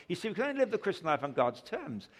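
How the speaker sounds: ordinary delivery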